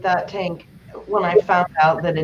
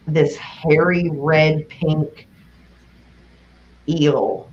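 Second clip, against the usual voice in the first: normal talking voice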